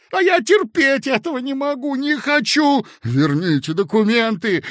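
clerk shrieking to get papers back; a desperate, strained outcry; hysterical protest. A narrator's reading.

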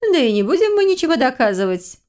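high-pitched indignant exclamation; lifted, emphatic outburst without shouting; exclaiming. A narrator's reading. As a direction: cry it out in protest. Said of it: "воскликнула его собеседница".

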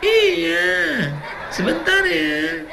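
flirty and gentle on the phone; soft, languid voice. Shown as a demonstration. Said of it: "suara yang lemah lembut". (suara perempuan lemah gemulai sambil tertawa kecil)

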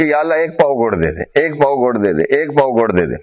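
low-pitched, repeated plea in prayer; retold prayer. Old worshipper begs God for jaggery in the mosque, low imitated prayer. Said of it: "اور اللہ سے دعا مانگی".

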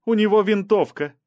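high, loud warning; urgent high-pitched alarm; alarmed cry. A narrator's reading. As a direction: cry out a sudden danger. Alarm at sighting a rifle.

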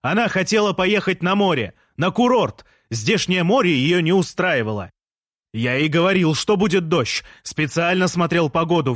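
aggrieved Jean. A narrator's reading. Jean complaining to the narrator about his wife. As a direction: complain like a wronged husband.

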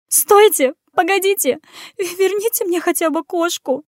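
pleading. Acted. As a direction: beg them to wait. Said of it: "взмолилась Анюта".

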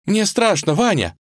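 put-on high, frightened girlish plea; sobbing fear. Vasilisa dangles from Ivan's hand in tears, frightened. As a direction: cry out to him in terror. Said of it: "она рыдала".

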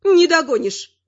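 quick-witted high granny retort; taunting granny. A narrator's reading. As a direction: toss it back in a high old-lady voice. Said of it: "констатировала бабуля факт".

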